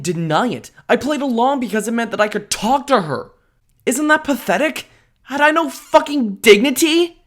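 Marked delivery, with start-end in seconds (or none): 3.9-7.2 higher, exasperated at himself; self-disgust. Acted. Narrator berating his own pathetic behaviour, exasperated. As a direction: scold yourself, disbelieving.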